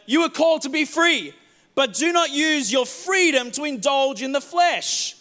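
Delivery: preacher reading Paul's words aloud; scripture reading. Acted. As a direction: proclaim the verse. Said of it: "Paul's saying".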